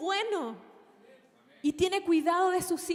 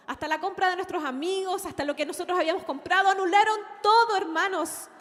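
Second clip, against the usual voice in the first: loud, rising excited announcement; retelling joyous news, getting louder; 3 semitones higher, 7 dB louder than usual. Retold or imitated. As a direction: build to a triumphant shout. Husband's excited news retold.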